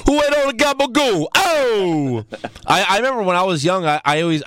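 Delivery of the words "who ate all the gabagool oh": high, hammed-up New York-Italian accent; exaggerated mock New York-Italian holler; New York-Italian caricature. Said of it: "We don't fucking talk like that".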